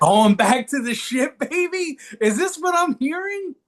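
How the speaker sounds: high, hyped-up teasing; excited teasing. Real, not acted. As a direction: egg him on, thrilled. Teasing co-host about World Series.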